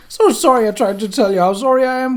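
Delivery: high, loud, rushed pleading; panicked grovelling. A narrator's reading. Cowering wizard babbling sorry to Albert. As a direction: babble apologies in fear.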